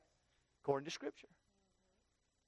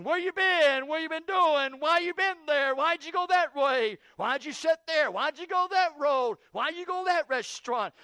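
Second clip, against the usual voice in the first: higher, louder string of suspicious questions; nagging accusation; 9 semitones higher, 8 dB louder than usual. Retold or imitated. Preacher mimics an accuser interrogating someone, loud and suspicious. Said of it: "You're always asking".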